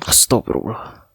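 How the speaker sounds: breathed astagfirullah under the breath; whispered exasperation. Acted. Girlfriend exasperated by her teasing partner, whispered. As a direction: sigh the word out in a whisper.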